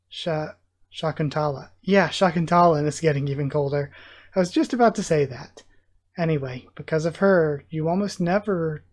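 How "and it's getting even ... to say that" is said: ordinary delivery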